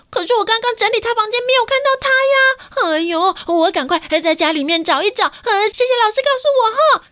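high, alarmed mother's voice; a mother thrown into a panic; mother hearing her child skipped school, startled and high. Acted. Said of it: "妈妈听到就说".

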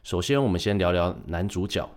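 ordinary talk

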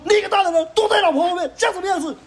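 retold scornful yell, very high. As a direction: shame the man hiding behind his wife. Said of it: "我说".

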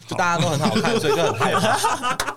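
laughing confession. Real, not acted. Admitting to the host she feared handsome teammates, laughing. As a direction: giggle it out, embarrassed. Admits fearing good-looking bandmates.

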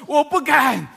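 high, loud comic backing down; husband loudly giving in, for laughs; loud surrender. Retold or imitated. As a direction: bellow the retreat as if it were brave. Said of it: "我大声的说".

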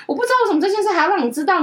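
high-pitched retold self-defense; indignant protest. Retelling own protest to mother. (高声辩解)

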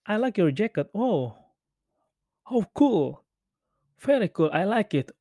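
a touch of wowed stranger; admiring passer-by. Acted. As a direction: toss out an impressed English compliment.

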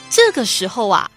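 ordinary talk

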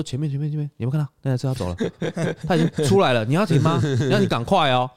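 egging the auntie to rush over; urging her on. Retold or imitated. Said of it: "我说". (高声催促阿姨快去)

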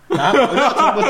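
laughing through the words; cracking up mid-story. Real, not acted. Telling friends how she played dumb, laughing.

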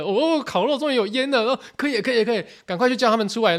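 high, eager cry of relief; finally, the fire is going; relieved excitement. Retold or imitated. Boys cheering as the grill finally smokes, high and eager.

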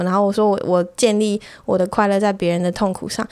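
ordinary delivery

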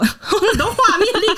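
real laughter; laughing. Real, not acted. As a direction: giggle through it. Laughing at memories.

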